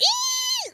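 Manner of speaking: very high, piercing "ee"; imitated squeal. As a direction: re-enact a starstruck squeal at the very top of your pitch. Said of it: "she shook my hand and I squealed".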